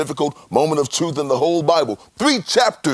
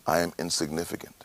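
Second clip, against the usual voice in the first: humble; 7 semitones lower, 9 dB quieter, faster than usual. Acted. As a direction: lower and much softer, a hushed admission. Job's first humble take.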